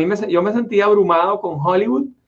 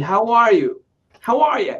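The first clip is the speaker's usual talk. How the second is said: higher-pitched gringo "how are you"; American accent; 5 semitones higher, slower than usual. Shown as a demonstration. Showing how gringos greet, imitated American accent. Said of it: "hablar como hablan los gringos".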